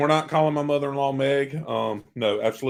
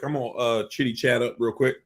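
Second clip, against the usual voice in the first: ordinary talk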